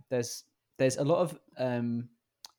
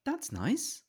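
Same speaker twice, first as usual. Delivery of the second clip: high dismissive that's nice; sarcastic reply; 14 semitones higher than usual. Retold or imitated. Parent to thirsty kid, sarcastic brush-off. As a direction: brush off the hint. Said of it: "And I'll go".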